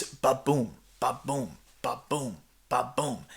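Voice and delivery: soft steady heartbeat, dying away; heartbeat rhythm. Shown as a demonstration. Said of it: "it really forms a beat".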